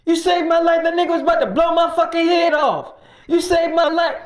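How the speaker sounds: overwhelmed thanks, near tears; crying gratitude. Retold or imitated. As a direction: Mike thanking David for saving his life. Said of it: "He started crying and stuff".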